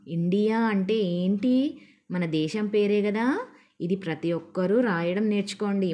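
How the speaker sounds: normal talking voice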